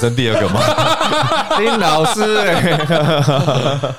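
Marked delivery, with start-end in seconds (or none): 1.1-3.9 very slow high words through laughter; laughing. Real, not acted. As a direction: laugh out the name. Host laughs at the joke.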